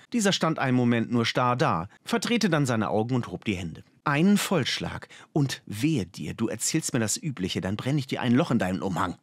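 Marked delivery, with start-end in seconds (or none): none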